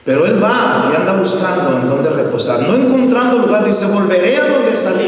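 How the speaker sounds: high, loud preacher's climb; fervent sermon build; preaching at full force. Acted. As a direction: preach it, building to the spirit's words.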